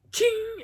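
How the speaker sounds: high ringing metallic ching; sudden high chain ring; imitated chain ching. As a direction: mimic a chain snapping taut. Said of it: "And the, the chains go".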